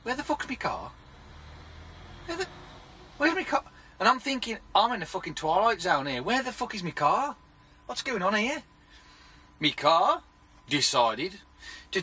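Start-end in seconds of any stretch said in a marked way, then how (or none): 0.0-8.6 panicked disbelief. Retold or imitated. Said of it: "and went".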